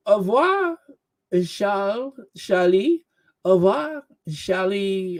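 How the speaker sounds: high, hammed-up French accent. Host mocking goodbye to Charlie, in a French accent. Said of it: "hearing myself do this French accent".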